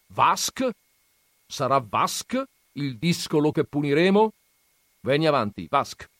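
gruff grandfather. Acted. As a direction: scoff at the books' dreamers. Grandfather calls the boy. (nonno burbero accennato, tono sprezzante)

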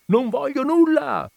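high, slow, loud refusal; enraged high-pitched yell; furious shout. A narrator's reading. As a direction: bellow it, drawing the words out. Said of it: "gridò Ziddima".

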